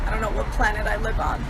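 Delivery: ordinary talk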